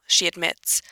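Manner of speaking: ordinary delivery, nothing marked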